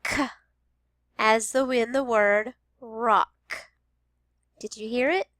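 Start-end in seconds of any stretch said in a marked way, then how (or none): 0.0-0.3 short k consonant, no vowel; crisp k burst; isolated k sound. Shown as a demonstration. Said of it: "The first sound is". 1.2-3.7 rock said slow and clear; slow teacher modeling; slow model word. Shown as a demonstration. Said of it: "The first sound is".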